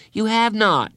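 indignant protest. Acted. Girls bickering over parties.

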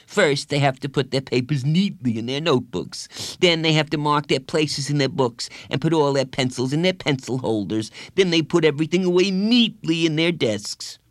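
put-on scornful kid, hitting each step; disgusted. A narrator's reading. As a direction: recite it as if it were revolting. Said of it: "as though it was the most disgusting thing".

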